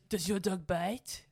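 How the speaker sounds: slow comic French detective voice; Pink Panther detective. Retold or imitated. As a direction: ask it slowly in mock French. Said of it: "That's the detective".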